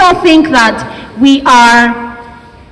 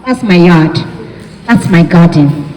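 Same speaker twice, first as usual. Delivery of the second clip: example sentences; 8 semitones lower than usual. Shown as a demonstration. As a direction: drop low for the example pair yard and garden. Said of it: "American English would say yard".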